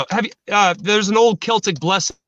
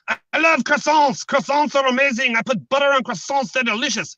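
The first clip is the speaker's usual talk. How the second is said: put-on higher-pitched comic French accent; mock French accent; 4 semitones higher than usual. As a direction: play a French croissant lover, French accent. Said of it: "If there was a French guy".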